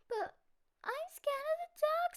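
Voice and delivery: high, small scared cub voice; a frightened little cub, voice way up high; little bear voice. Acted. Cub tells father his fear.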